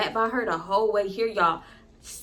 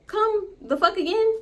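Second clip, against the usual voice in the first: high disbelieving rise; can't believe he said that; stunned disbelief; 10 semitones higher than usual. Retold or imitated. Girlfriend hearing boyfriend's refusal, stunned high rise.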